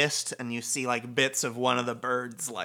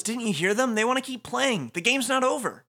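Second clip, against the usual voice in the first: high, pleading character voice; urgent protest; 11 semitones higher, 3 dB louder than usual. Acted. Rindo to the teammate who is leaving, pleading. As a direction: plead with him at a raised pitch.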